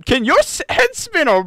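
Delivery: high, loud goofy voice; imitating Ray. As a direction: squeak it out like a weird guy in his car. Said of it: "if you saw a man in his car going".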